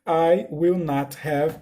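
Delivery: slow, careful English model; slow corrected example. Shown as a demonstration. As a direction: say "I will not have" word by word. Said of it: "confundi tudo aqui".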